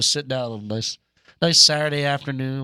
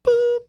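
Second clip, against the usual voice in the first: phone beep; 8 dB louder than usual. Retold or imitated. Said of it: "imitating a phone tone".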